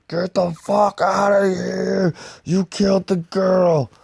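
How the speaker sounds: Kane yelling in rage at the mysterious lady; furious shout. Retold or imitated. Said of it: "Kane's like".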